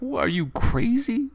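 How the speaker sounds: high, loud, disbelieving cry; mock outrage at the crazy kid; incredulous shout. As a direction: yelp it in total disbelief. Reacting to the boy going back.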